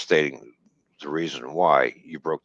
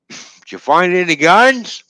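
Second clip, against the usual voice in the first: much higher, louder and slow, rubbing it in; mock teasing; 11 semitones higher, 6 dB louder, slower than usual. Driver ribbing the rookie officer about guns, slow and mocking. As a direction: needle him with a drawn-out taunt.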